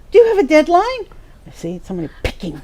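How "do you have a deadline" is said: higher and louder, rising question; the new friend asking why she refuses, mock concerned; friend's probing question. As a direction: quiz her like a nosy friend. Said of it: "And she said".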